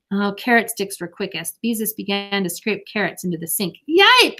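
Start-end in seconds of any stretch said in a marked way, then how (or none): 3.9-4.4 high, loud yelp of alarm; yell. A narrator's reading. Ramona on the counter spots trouble, yelling. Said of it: "yelled Ramona from the counter".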